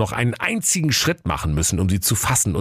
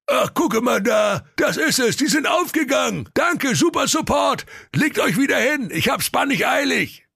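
elated ogre, high and shouting; relieved ogre. Acted. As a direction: wave off the helpline, in a hurry. Ogre finds untied laces.

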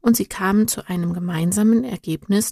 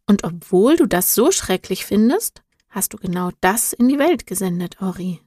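plain reading voice

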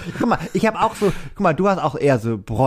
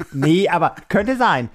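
usual speaking voice

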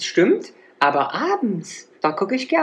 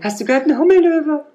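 amused and excited, higher and louder; gleeful about the silly name; delighted; 10 semitones higher, 5 dB louder than usual. Real, not acted. Friend delighting in the joke name Hummellöwe, excited.

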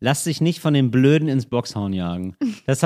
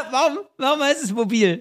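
high, giggly questions through laughter; helpless laughter breaking into the words; laughing; 11 semitones higher than usual. Real, not acted. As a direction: crack up while asking. Cracking up at own joke.

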